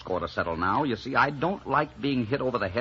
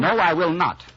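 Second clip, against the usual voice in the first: loud, raised outburst; angry refusal; 7 semitones higher, 8 dB louder than usual. Acted. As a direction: refuse point-blank, voice up and hard. Heated quarrel, flat refusal.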